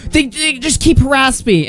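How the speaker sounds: high-pitched mock complaint; mock whining. Retelling how they complain of harassment, in a mock whine. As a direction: whine it high, mimicking a victim.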